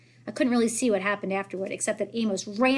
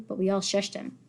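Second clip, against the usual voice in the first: ordinary delivery, nothing marked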